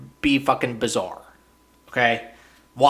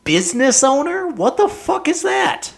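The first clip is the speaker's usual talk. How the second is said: much higher, disbelieving squeal; mock outrage at the title; high-pitched exasperated questions; incredulous rant; 13 semitones higher than usual. Real, not acted. Ranting at vague title.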